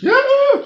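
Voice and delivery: sudden high-pitched squeal. Acted. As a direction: squeal it out with glee. Squeal during the dance chant.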